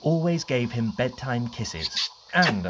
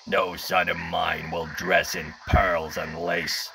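stern father; 8 semitones lower than usual. Retold or imitated.

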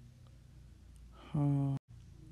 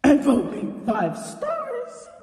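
excited; 10 semitones higher, 9 dB louder, slower than usual. Real, not acted. Cheering on her own entry.

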